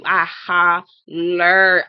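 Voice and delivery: slow, loud, emphatic drawl; drawn out for emphasis. Real, not acted. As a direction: stretch each word out loud and slow. Exaggerates how hard she laughed.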